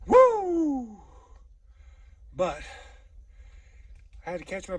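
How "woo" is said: high, loud wrestler's woo; Ric Flair woo. Pretend. Hiker at the crest whoops like Ric Flair. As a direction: let out a big wrestling-promo woo. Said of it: "Feel like Ric Flair".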